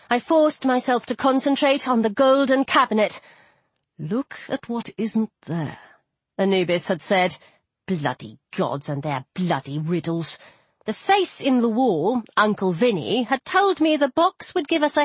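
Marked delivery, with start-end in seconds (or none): 4.0-5.8 soft, slow, cryptic line; hushed, measured remembered words; recalled god. Acted. Recalls the god's riddle. 7.9-10.4 low, soft, irritated aside; disgruntled low mutter; grumbling. Acted. Mutters to herself about gods.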